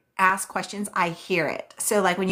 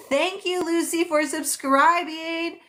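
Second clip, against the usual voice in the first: bright, much higher and slowed thank-you; delighted thanks; 10 semitones higher, slower than usual. Real, not acted. Streamer thanking Lucy for subscribing, delighted and drawn out. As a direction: gush over the new subscriber.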